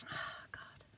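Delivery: breathy whispered oh God; whispered sigh. Real, not acted. Emotional vlogger whispering to herself, overwhelmed. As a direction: exhale it, overwhelmed.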